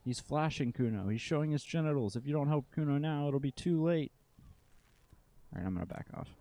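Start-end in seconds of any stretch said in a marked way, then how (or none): 0.0-4.1 put-on high shrill child's cry. Kid shrieking to neighbours.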